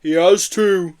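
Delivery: loud, high, menacing grumble; growling yeti. A narrator's reading. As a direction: grumble it like a big creature. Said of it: "growled Marbo".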